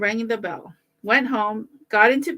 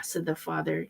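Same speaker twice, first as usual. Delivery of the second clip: ordinary delivery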